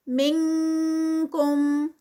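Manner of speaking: منکم very slow, with the ن hidden toward ک; Quran recitation. Shown as a demonstration. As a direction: recite منکم with ikhfa before kaf. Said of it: "اخفا ہوگا".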